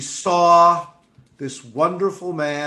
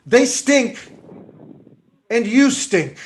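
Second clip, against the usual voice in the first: loud, higher scolding father; retold scream at daughter; 6 semitones higher, 4 dB louder than usual. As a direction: bark it out like a fed-up father. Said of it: "He would scream at her".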